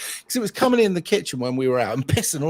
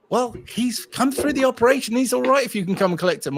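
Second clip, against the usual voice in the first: imitated vet, raised pitch; vet on the phone; 9 semitones higher, 3 dB louder than usual. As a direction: lift it into a higher caller's voice. Vet phones with good news.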